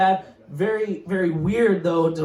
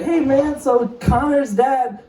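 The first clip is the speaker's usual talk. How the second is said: son's voice; 8 semitones higher, slower than usual. Retold or imitated. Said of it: "he's like".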